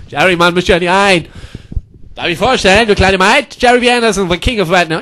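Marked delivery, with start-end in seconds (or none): none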